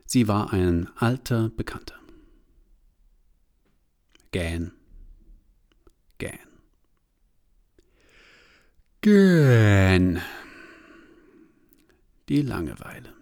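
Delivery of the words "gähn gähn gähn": 1 yawning. A narrator's reading. Narrator voices yawning.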